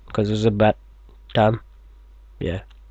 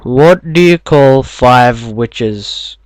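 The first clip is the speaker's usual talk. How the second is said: slow; 6 dB louder, much slower than usual. Acted. Slow emphatic joke reading.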